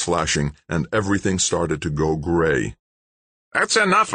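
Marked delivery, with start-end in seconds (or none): 3.5-4.1 put-on high, raspy old man's command; sandpaper-voiced old man. A narrator's reading. Old man calling off his giant friend, high sandpaper voice. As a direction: rasp it high to call off a brawler. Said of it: "said in a voice like sandpaper".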